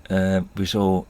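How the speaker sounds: ordinary delivery, nothing marked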